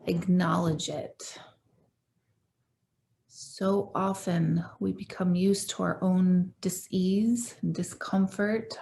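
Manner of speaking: calm, unhurried meditation guide with long pauses; measured phrases, leaving room to breathe; guided meditation. Real, not acted. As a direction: gently lead listeners into their breath and body.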